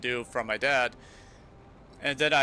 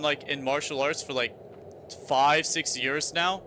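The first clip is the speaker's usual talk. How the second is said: ordinary talk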